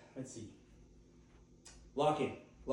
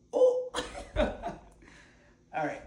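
sudden high yelp into a laugh; surprised laugh; 13 semitones higher, 7 dB louder, much slower than usual. Real, not acted. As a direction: burst out laughing after a wobble. Nearly falls, laughs.

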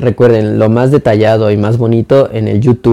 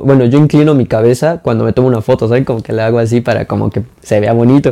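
ordinary delivery

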